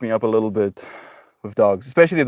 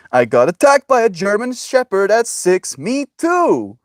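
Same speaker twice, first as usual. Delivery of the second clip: mock chat voice; 11 semitones higher, 5 dB louder than usual. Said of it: "reading a chat message aloud".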